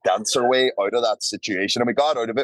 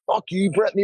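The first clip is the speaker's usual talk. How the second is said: mock cheering yell; 9 semitones higher, faster than usual. Cheering bridesmaids.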